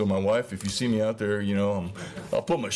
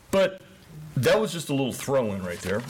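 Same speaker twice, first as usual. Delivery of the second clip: ordinary talk, nothing marked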